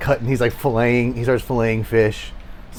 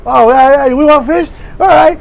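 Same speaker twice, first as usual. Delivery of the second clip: loud, high burst of eagerness; excited; 9 dB louder than usual. Acted. Character answering hungry friends who want fish, loudly excited. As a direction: leap on the request, pitched way up.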